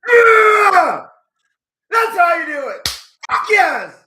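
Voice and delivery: screaming high and loud with joy; ecstatic victory shout. Real, not acted. Fan roaring as Lando wins the race, ecstatic shout. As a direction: let the win burst out of you. Win on the final lap.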